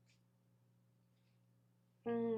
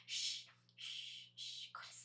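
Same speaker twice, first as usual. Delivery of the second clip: hushed shushing, lulling to sleep; whispering over a crib; 17 dB quieter, slower than usual. Real, not acted. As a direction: whisper softly so she sleeps. Hushing the cat to sleep.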